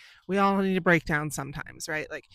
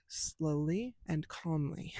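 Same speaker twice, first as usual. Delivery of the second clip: slow, softer, measured words; slow and calm; 6 dB quieter, much slower than usual. Shown as a demonstration. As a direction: force yourself to slow right down. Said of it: "forcing yourself to talk".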